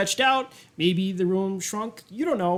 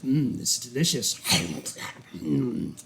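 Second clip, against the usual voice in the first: lower, hushed mock courtesy, trailing off; gloating villain; 5 semitones lower, 3 dB quieter than usual. Villain relishing a meal before starving kids, gloating. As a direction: savour the food, then taunt softly.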